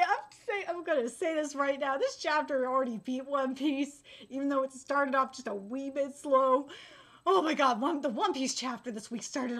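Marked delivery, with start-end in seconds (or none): none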